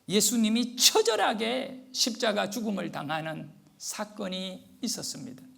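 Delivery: ordinary talk, nothing marked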